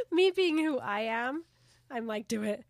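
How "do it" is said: mock chant, getting louder; loud, goading little chant; playful egging-on. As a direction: cheer them on with mounting insistence. Eggs on the character's kiss.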